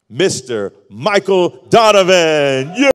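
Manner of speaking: high, loud, slow emcee call of the guest's name; stretched-out announcer shout of the guest's name; announcer intro. Real, not acted. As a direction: bring him on like a ring announcer.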